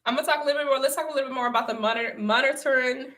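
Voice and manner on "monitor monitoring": MONITORING slurred in a country accent as "munnerturn"; country-accented "monitoring", syllables run together; country accent slipping out. Real, not acted. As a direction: let a country accent swallow the middle of "monitoring". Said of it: "that's my accent coming out".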